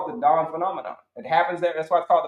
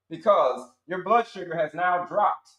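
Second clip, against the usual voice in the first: ordinary delivery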